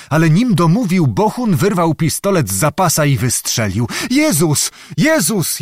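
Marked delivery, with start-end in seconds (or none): 4.1-5.6 high, drawn cry of a wounded man; moaning cry. A narrator's reading. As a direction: moan out in pitched-up shock. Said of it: "jęknął kniaź Symeon". (wysoki jęk rannego)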